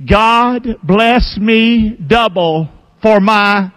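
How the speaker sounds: slow, drawn-out phrase for the crowd to repeat; spelled-out chant for the congregation; call and response. Shown as a demonstration. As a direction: lead the room word by word. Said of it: "Say it with me".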